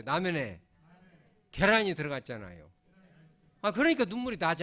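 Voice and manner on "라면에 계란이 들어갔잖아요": loud call-and-response, 라면에 and 계란이 spaced out with a pause between; lead the repeat. Shown as a demonstration. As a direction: read it out loud for the church to repeat. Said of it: "우리 한번 따라서 하죠".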